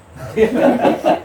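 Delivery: loud, high breaking laugh; hearty laugh. Real, not acted. Lecturer amused by his own advice, laughing. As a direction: burst out laughing. Laughs after remark on luck.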